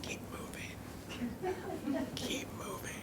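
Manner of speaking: urgent whisper. Retold or imitated. Mother hurries her son past, whispering urgently. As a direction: hiss it under your breath so nobody notices. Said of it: "She was like".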